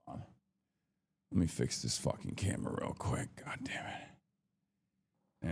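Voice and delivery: annoyed whisper aside while fixing a camera; muttered whisper. Real, not acted. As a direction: mutter it to yourself while fiddling with gear.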